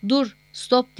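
understated shout of command. A narrator's reading. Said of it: "diye bağırdılar".

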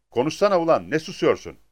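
acted annoyance, pressing for an answer; irritated demand. A narrator's reading. Pimp berates silent woman. (sinirli, yüksek sesle çıkışma)

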